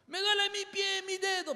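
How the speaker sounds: high, plaintive patient voice lifted well above his own; high whiny patient insisting it hurts; complaining patient. Retold or imitated. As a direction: play the amputee whining about a missing toe. Lecturer voices amputee patient.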